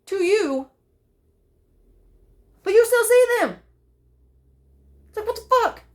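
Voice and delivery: high, crying voice breaking; real sobs pushing the words up. Real, not acted. Grieving her living parents aloud, crying hard.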